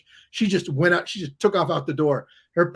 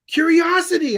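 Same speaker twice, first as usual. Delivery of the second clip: higher and louder, struck by the idea; eureka moment; 11 semitones higher, 7 dB louder than usual. Retold or imitated. Remembering the idea for the book, an excited eureka. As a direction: announce the discovery to yourself.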